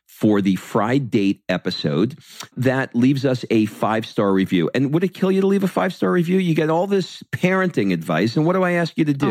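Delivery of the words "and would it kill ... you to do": higher, fast pleading nag; guilt-trip them playfully; would-it-kill-you mock whine. Begging for reviews.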